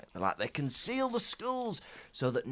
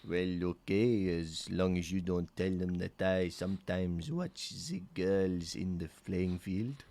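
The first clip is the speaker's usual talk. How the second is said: Madame Maxine role; 7 semitones lower, 4 dB quieter than usual. Pretend. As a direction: answer in a deep, measured character voice.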